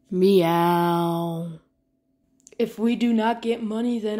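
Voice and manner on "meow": drawn-out lower meow; cat meow. Acted. Player meowing at the owner.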